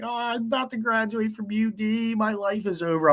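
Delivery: put-on high, weepy voice for the crying girl; mock crying girl. Crying girl in the paddy wagon, done high and weepy. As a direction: play the hysterical girl, lightly. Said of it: "She's like".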